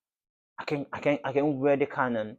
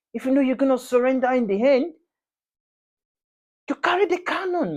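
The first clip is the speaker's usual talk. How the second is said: much higher and louder, building outrage; real furious rant; 9 semitones higher, 5 dB louder than usual. Real, not acted. Fan raging at Arsenal for losing two finals, much higher and louder. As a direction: rail at the club in disbelief.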